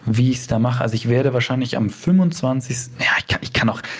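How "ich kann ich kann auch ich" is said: whispering. Real, not acted. Unsure, drops to whisper.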